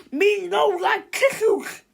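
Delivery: high, loud, slow broken-English cry; panicked monster-speak. A narrator's reading. Melvin refusing tissues, panicked monster voice. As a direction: wail it like a scared brute. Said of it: "in a panic".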